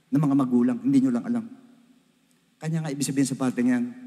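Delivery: ordinary talk, nothing marked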